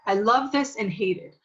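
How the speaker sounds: ordinary talk, nothing marked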